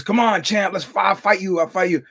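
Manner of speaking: loud, high challenge from a cocky fan; mock heckle. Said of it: "And he goes".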